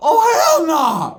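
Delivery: a burst of laughter into a loud, high shout; laugh-yell it in disbelief. Retold or imitated. Spotting the rival crew at the flea market, a laughing, disbelieving shout. Seeing the rival gang arrive.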